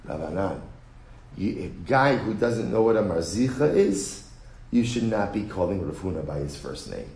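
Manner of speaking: low, soft scolding; quiet rebuke. Retold or imitated. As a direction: chide him gently but firmly. Mar Ukva scolds Rav Anan.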